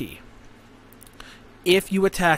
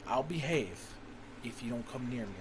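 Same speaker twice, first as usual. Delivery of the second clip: patient's sly deal; 5 semitones lower, 16 dB quieter than usual. Retold or imitated. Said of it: "He's like".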